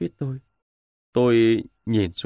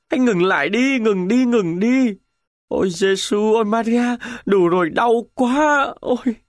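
dying soldier's moan building to a scream; 8 semitones higher than usual. A narrator's reading. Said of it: "rồi rên rỉ".

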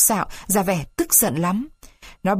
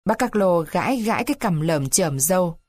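ordinary delivery

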